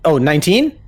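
high loud impressed Oh; surprised. Real, not acted. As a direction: caught off guard by the roll. GM reacts to high roll.

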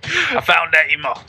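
put-on hammy Australian accent. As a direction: do a rough Aussie impression. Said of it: "do it in your Australian accent".

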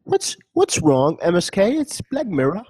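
high, slow mock British accent; stretched-out, hammed-up British accent. Co-host teasing M S K in a British accent. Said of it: "say in a British accent".

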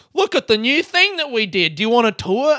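sweetly offering a tour, mocking; mock website voice. Annoyed user mimicking a website popup, chirpy. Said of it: "they're like".

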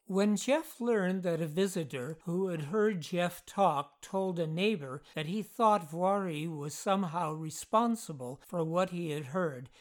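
plain reading voice

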